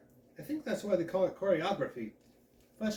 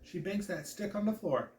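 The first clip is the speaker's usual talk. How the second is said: usual speaking voice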